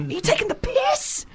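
mock incredulous squeal; squeaky disbelief. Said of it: "you're just like".

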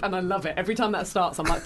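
usual speaking voice

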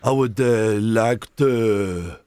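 slow, hammed-up French accent. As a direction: lay on a fake French charm.

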